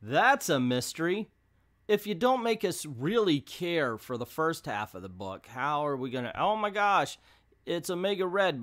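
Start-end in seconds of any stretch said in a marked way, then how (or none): 0.0-1.3 high, louder fake wonder; hammed-up fanboy awe; mock amazement. Reviewer imitating the expected reader reaction, mock amazed. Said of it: "are we supposed to go". 6.4-8.6 higher, louder fake astonishment; hammed-up surprised reader; mock shock. Reviewer imitating readers at the villain reveal, mock astonished.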